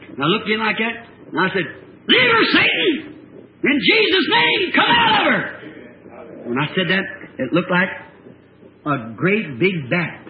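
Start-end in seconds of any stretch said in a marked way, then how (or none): 2.1-5.4 high, slow shouted command; exorcism shout. Retold or imitated. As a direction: bellow the devil out, word by word. Re-enacts casting out Satan.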